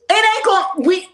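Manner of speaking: loud, heated cut-in; indignant interruption. Real, not acted. Cutting in over his talk of harmony, indignant interruption. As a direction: break in loudly, fed up. Cuts in angrily over played clip.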